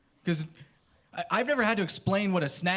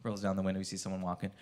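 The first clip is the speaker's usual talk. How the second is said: usual speaking voice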